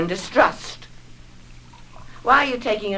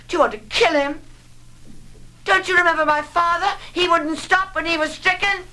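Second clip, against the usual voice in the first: rising alarm; 10 semitones higher than usual. Acted. Mother frightened for her son, voice climbing in alarm. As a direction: pitch climbing high with fear.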